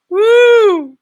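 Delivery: high, loud whooping cheer; excited woo. Real, not acted. Shouting out a fan page, a loud happy woo. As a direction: let out a big joyful woo.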